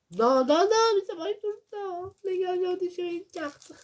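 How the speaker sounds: high, dismayed cry of no; dismayed outburst. Real, not acted. Vlogger telling viewers he tore a card, high dismayed wail.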